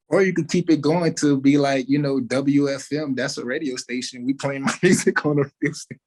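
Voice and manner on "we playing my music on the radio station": laughing. Real, not acted. Joking about radio play.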